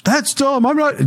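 high, petulant protest; whiny refusal. Retold or imitated. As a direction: whine it like a stubborn client. Client refusing jumping jacks.

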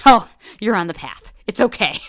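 mother telling her crying daughter it's fine, mock soothing; mother reassuring. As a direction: play the mother humoring her grown daughter. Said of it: "and she says".

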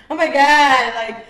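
loud, drawn-out jokey blurt; trying to be funny. Retold or imitated. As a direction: imitate yourself loudly playing it for laughs. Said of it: "I would try to be funny".

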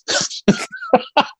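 a sudden high-pitched laugh. Real, not acted. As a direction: burst into a squealing laugh. Laughs at the accent request.

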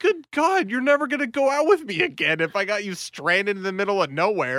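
high outburst easing down as it runs; mock exasperated dismay. Boyfriend despairing at his date's blunder, mock exasperation. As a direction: exclaim it high, in retold panic. Panicked reaction to her blunder.

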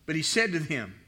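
ordinary delivery

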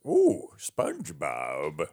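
drawn-out Plankton cartoon impression. Shown as a demonstration. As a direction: draw the words out slowly like a cartoon villain. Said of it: "try to do a Plankton voice".

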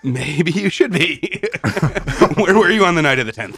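laughing. Pretend. Mock interrogation, laughing.

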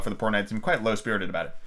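plain reading voice

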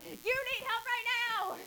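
high urgent alarm; urgent concern. Retold or imitated. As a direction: insist they get help right now. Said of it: "Or it can be like".

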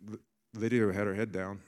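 ordinary talk

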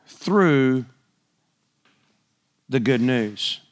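deliberate reading with long pauses between phrases; slow, spaced-out scripture reading. Shown as a demonstration. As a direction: read it out phrase by phrase, stretching the key words. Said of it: "I wanna read this slow".

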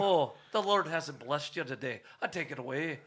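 hammed-up Irish clergyman; mock Belfast accent. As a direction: pronounce judgement in a comic Irish brogue. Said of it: "straight from Belfast".

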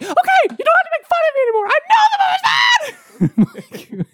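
rapid falsetto shouting; hammed-up friend's meltdown in a piercing high voice; John's old voice. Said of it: "that's how he talked".

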